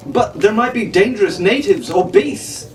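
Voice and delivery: a mild, earnest protest. A narrator's reading. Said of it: "Fitzwilliam protested".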